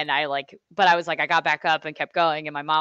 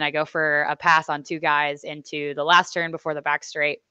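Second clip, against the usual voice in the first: usual speaking voice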